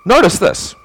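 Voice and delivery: ordinary delivery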